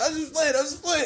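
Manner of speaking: high, laughing mock-scared kid plea; hammed-up kid voice, half giggling; Little Chris from Friday. Boy one pleading with the girl, in a high giggling kid voice. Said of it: "Like little Chris from Friday".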